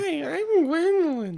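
put-on very high, slow girly doll voice. Friend voicing a Barbie doll, very high and slow. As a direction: squeak it out like a talking Barbie.

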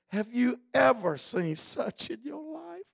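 high, tearful pastor; crying pastor. Retold or imitated. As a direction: imitate the pastor close to tears. Pastor quoted, near tears.

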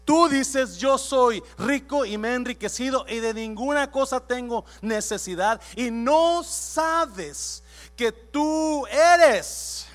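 loud, slow, emphatic preaching; preaching at full force. Acted. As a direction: hammer each line home to the church. Preaching Jesus' rebuke. (predicación a todo pulmón)

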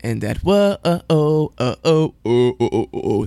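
loud chanted whoa-oh goal song; imitating goal horn. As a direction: mimic the goal celebration chant. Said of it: "that dang cannon".

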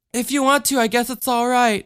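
put-on high, shy childlike reply; little girl voice. A narrator's reading. Michelle answers Ma Marie.

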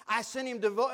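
normal talking voice

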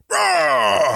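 mock whale roar, drawn out; imitated whale roar. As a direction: roar like a huge whale, half joking. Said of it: "he roars".

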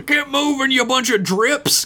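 high cartoon sergeant barking orders; drill sergeant. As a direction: yell like a tiny sergeant. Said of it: "a drill sergeant sperm".

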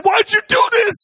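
high, loud frightened wail; frightened son. Retold or imitated. Son scared on the steep hill, high frightened wail.

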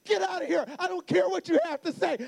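high, loud mock tantrum; furious little daughter. Daughter orders dad out of room, imitated angry yell. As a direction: imitate a child throwing dad out.

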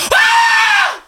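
very high, drawn-out falsetto shriek; Amy as screaming fangirl. Retold or imitated. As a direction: squeal like a starstruck fan losing it. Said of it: "Here's my impression of Amy".

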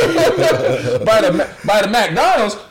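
very high, loud country American; hammed-up Southern American bumpkin, incredulous; American accent. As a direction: shout it in a mock American twang. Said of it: "that's my American accent".

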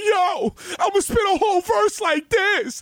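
high, stretched-out mock rap delivery. Fan recalls rapper's radio freestyle, high-pitched imitation. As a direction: push it way up, hammed-up battle boast.

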